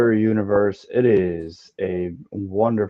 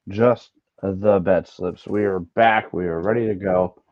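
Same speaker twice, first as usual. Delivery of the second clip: normal talking voice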